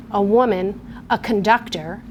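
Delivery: ordinary delivery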